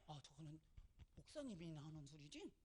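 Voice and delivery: very soft, low doubter's voice; hushed sceptical remark to oneself; acted dismissive aside. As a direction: imitate a listener privately shrugging it off. Voicing a doubting church member again.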